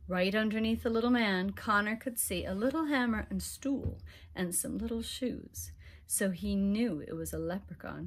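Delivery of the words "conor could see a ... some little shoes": usual speaking voice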